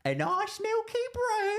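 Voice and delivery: very high, slow mock Cornish accent; put-on Cornish-style accent. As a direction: draw it out in a squeaky attempt at Cornish. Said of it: "Can you do a Cornish accent?".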